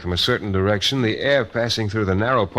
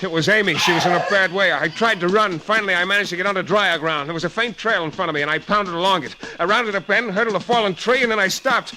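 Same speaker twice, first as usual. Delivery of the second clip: urgent, rising narration; 9 semitones higher than usual. Acted. Narrator racing to Amy's cry, urgent and higher. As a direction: pitch climbing higher as he runs.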